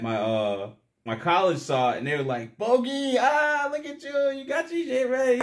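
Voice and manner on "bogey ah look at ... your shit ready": high, hyped voice of teasing friends; teasing buddies. Retold or imitated. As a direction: play the hyped-up homeboys ribbing him. Homeboys teasing him, quoted.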